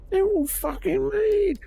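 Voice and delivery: exasperated yell. Real, not acted.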